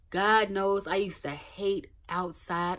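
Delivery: ordinary talk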